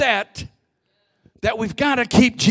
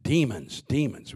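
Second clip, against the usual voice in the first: much lower and softer; low ominous repeat; 8 semitones lower, 7 dB quieter than usual. Real, not acted. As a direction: murmur it like a dark warning. Preacher drops voice for emphasis.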